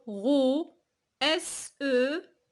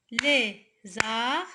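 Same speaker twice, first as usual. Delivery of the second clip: "Lézard" split as LÉ, pause, ZARD; syllable by syllable; 4 dB louder than usual. Shown as a demonstration. Teacher counting syllables for pupils, "Lézard" chopped in two. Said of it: "deux syllabes".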